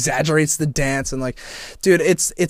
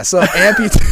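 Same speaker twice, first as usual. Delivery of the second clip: laughing; 8 dB louder than usual. Real, not acted. Laughing at own word slip.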